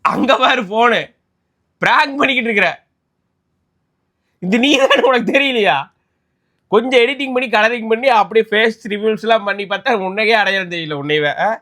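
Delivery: loud and high, bursting with glee; husband revealing the prank to his wife, thrilled; excited prank reveal. Real, not acted. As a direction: shout the reveal with delight.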